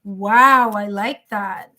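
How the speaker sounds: ordinary talk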